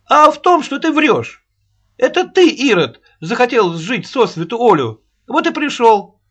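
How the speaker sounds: put-on very high, loud accusing old woman; shrill nagging grandma. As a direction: accuse him like a furious nanny. Аннушка quarrels.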